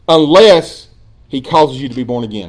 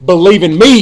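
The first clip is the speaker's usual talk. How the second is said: loud, forceful order; commanding, punched-out imperative; firm command; 3 semitones higher, 7 dB louder than usual. Acted. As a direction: give it as an order, not a request. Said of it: "It's a command".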